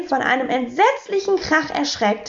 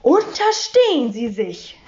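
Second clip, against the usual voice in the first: indignant warning cry; empört. A narrator's reading. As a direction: warn him off with indignation. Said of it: "rief sie empört". (empörter Ausruf)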